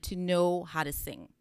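usual speaking voice

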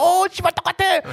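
very high astonished swear; amazed fans. Retold or imitated. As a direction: shriek it in disbelief. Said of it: "They're like".

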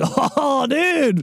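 high-pitched stoked bro impression; exaggerated, drawn-out bro voice; surfer bro. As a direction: play a hyped surfer dude. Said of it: "like, the bro".

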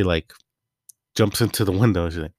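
ordinary talk

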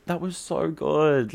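high, loud, drawn-out gushing; badly faked enthusiasm for an awful performance; slow, over-sweet mock compliment; fake praise. Feigned praise after his monologue performance.

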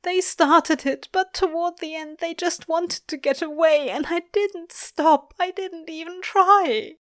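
high, loud, anguished outpouring; sobbing confession. A narrator's reading. Angel confessing while clinging to Crowley, anguished. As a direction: confess through grief, slowing at the end. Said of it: "sobs openly, loudly".

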